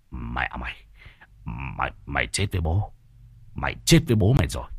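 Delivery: menacing man, pitched well down; growing threat in a low voice; low angry threat. A narrator's reading. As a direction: threaten them low, then speed up. Man threatens to kill the hen.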